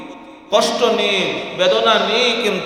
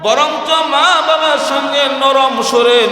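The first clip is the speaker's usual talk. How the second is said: preaching at full force; 7 semitones higher than usual. Real, not acted. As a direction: drive the point home to the crowd. Preacher raising voice to congregation. (উচ্চস্বরে ওয়াজ)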